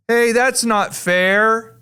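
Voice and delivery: slow, high-pitched complaint; imitated whiny objection from the back row; protesting student. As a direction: draw out a mock protest.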